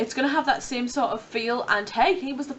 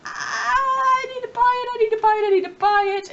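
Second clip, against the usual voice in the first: high, loud, urgent chant of need; squealing I-need-it voice; excited pleading; 12 semitones higher, 5 dB louder than usual. Pretend. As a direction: beg like a giddy shopper. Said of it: "so I was just like".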